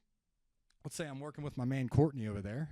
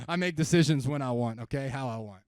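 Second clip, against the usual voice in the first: defiant prospect; 10 dB louder than usual. Retold or imitated.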